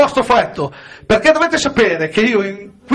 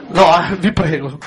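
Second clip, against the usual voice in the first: normal talking voice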